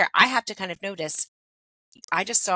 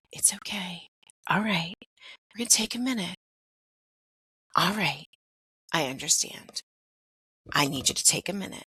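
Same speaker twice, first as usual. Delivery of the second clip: lowered, softened voice. Shown as a demonstration. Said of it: "I'm basically lowering my voice".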